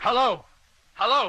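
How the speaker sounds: loud, very high repeated hail; shouted calling. Acted. Captain calling silent freighter, shouting. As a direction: shout into a dead line.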